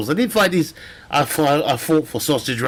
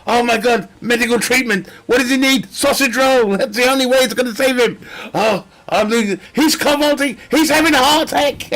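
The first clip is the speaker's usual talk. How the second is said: frantic panic; 8 semitones higher than usual. Acted. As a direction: sound like a medic in a crisis. Acted medical emergency.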